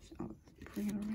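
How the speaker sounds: whispered aside to viewers; whispered card-reading aside. Real, not acted. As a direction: murmur it under your breath. Shuffling cards, aside.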